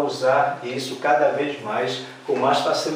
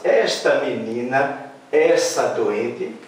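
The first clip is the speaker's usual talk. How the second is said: slow, drawn-out "essa doente"; slow wrong example; slower than usual. Shown as a demonstration. Teacher to class, slow wrong example. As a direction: stretch "Esta menina, essa doente" as a wrong model. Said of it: "Se também eu falasse".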